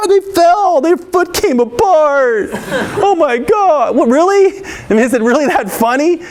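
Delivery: high, giggling mockery; mocking laughter. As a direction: imitate kids laughing at someone who fell. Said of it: "you were laughing at them".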